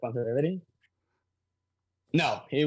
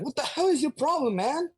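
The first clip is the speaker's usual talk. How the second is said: higher, annoyed yell at a bystander; angry cop; 9 semitones higher than usual. Retold or imitated. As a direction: chew him out like a cop. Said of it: "The cop is like".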